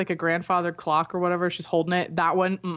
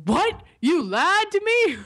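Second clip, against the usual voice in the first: high, drawn-out shocked dad; mock indignant father, pitched way up; outraged dad; 11 semitones higher, 4 dB louder, slower than usual. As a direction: play a scandalized dad popping up. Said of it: "expect your dad to pop up and go".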